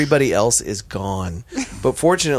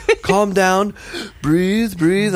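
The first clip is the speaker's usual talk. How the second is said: higher, soothing mock coach; 8 semitones higher, much slower than usual. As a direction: imitate a fussy helper telling you to breathe. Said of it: "helpful tips".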